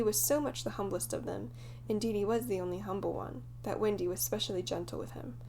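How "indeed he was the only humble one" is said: ordinary delivery, nothing marked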